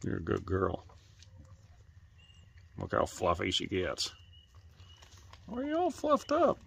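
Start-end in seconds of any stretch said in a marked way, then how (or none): 5.5-6.6 sing-song puppy talk; pet voice. Real, not acted. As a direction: coo it up high to the dog. Cooing to fluffed-up bird.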